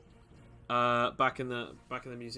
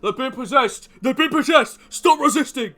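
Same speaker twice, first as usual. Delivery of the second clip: much higher, louder frantic yell; elf yelling; 11 semitones higher, 14 dB louder than usual. Acted. Elf guard shouts at the possessed nerds, frantic yell. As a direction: scream at the prisoners to stop. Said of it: "the elf is like".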